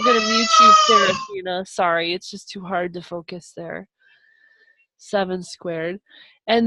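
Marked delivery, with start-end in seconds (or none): 0.0-0.7 a sharp high shout over the noise; sudden shout. Real, not acted. Teacher shouting at a noisy student. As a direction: yell to cut through the noise.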